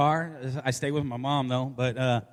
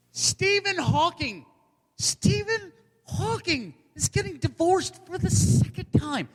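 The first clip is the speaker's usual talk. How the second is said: incredulous; 7 semitones higher than usual. Acted. Single comedian telling crowd of another's divorce, high incredulous cry. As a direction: stretch the name high in outrage. Disbelief at Hawking's second divorce.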